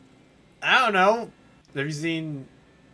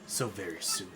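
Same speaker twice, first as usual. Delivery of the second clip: low, menacing evil boss; low sinister villain giving orders; 6 semitones lower, 5 dB quieter, faster than usual. Acted. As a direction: growl orders like a scheming tyrant.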